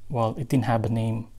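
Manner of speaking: ordinary talk, nothing marked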